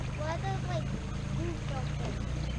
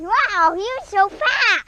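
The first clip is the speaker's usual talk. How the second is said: loud, thrilled and higher; excited; 6 semitones higher, 11 dB louder than usual. Real, not acted. Boy watches fish swim away.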